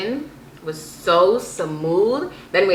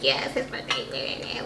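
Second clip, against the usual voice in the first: slow, soft whispered mock reply; whispered entourage reply; 4 semitones lower, 8 dB quieter, much slower than usual. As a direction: whisper it slowly like the hushed entourage. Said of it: "people was like".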